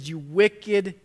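a king condemning the unforgiving servant; stern rebuke. Retold or imitated. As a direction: drag out the accusation with weight. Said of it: "the master called the servant in and he said".

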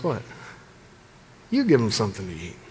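quick mock disbelief, tossed back; a hint of an incredulous Jesus. Jesus turning to his disciples, a touch incredulous. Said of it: "he turns and says".

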